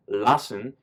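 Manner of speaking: ordinary delivery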